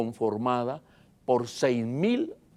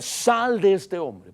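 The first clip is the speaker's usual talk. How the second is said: higher, slow, drawn-out order; retold command; 6 semitones higher, 3 dB louder, much slower than usual. Preacher quoting Jesus ordering the demon out, commanding. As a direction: cast it out as Jesus would. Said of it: "porque le decía".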